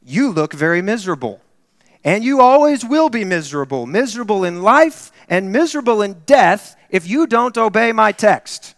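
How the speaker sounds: put-on preacher rebuking a teenager, loud; stern preacher warning. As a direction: warn the miserable young man. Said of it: "and said".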